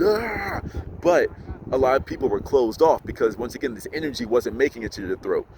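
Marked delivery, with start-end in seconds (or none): 0.0-0.6 high-pitched strained "aah"; mock yell. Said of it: "you're yelling".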